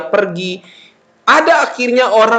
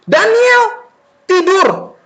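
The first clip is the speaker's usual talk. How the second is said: put-on high, firm call to a child; parent ordering; 12 semitones higher, much slower than usual. Parent sends child to sleep, commanding call. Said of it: "Kalau papa mama bilang gini".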